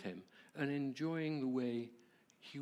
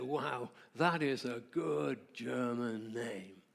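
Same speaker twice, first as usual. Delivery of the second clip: slow, lightly put-on German accent; a mild German accent for the old man; slower than usual. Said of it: "lovely, deep German accent".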